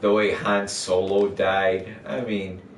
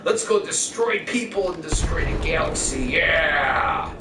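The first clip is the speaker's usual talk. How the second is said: gleeful villain; 4 semitones higher than usual. Retold or imitated. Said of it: "I'd be like".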